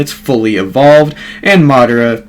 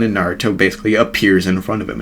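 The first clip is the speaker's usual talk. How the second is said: ordinary talk, nothing marked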